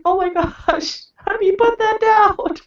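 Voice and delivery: high, flustered panic; panicked mom. Retold or imitated. Mom retells panicking at her son holding the toy, high flustered squeak. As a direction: squeak out a horrified mom's question. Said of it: "And I was like".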